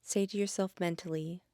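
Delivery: gentle Yoga Nidra guide voice; guided meditation. Acted. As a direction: ease the listener into stillness.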